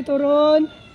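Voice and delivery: ordinary talk, nothing marked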